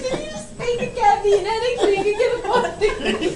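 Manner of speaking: very high, loud and laughing; incredulous laughing accusation. Retold or imitated. Daughter calling out Dad's fake headache, laughing in disbelief. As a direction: squeal the accusation through laughter. Retells accusing Dad, cracking up.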